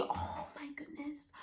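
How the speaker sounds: breathless unvoiced whisper. Real, not acted. Whispering to viewers about mom calling. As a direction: whisper it in a panic aside. Aside while retelling panic.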